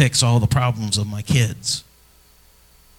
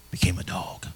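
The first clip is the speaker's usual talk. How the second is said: whispered punchline; 5 semitones lower, 11 dB quieter than usual. Real, not acted. Punchline about the family dog.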